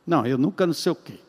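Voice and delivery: levemente imitado, baixo e displicente; mock blasé optimist, low-pitched; alienado positivo. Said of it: "um alienado falando coisas positivas". (alienado falando positivo, em voz baixa)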